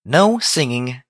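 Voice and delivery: stern. A narrator's reading. Twilight forbidding Pinkie's song, stern. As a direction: lay down the rule firmly. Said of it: "Twilight Sparkle said sternly".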